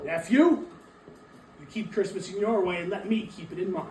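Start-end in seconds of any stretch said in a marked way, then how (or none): 0.0-0.7 a loud, irritated snap, higher than his usual voice; curt loud rebuke to the nephew; sharp angry bark. Acted. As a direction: cut him off with a bark. Scrooge snaps during quarrel.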